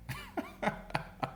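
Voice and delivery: high unvoiced wheezing laugh; breathless cackle. Real, not acted. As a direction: let out a squeaky mocking laugh. Cackle after insults.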